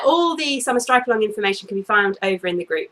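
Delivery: ordinary talk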